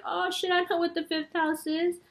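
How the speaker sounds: light imitation of an excited viewer realizing something. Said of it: "all my viewers sound like that".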